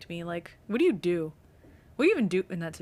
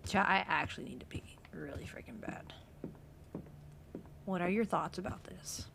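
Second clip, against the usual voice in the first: whispering; 10 dB quieter than usual. Real, not acted. Whispers to chat.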